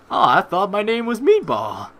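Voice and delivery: normal talking voice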